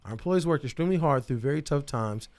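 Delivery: formal statement read aloud. Real, not acted. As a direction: read it like a press release. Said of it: "reads spa statement aloud".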